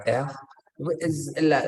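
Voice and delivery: ordinary delivery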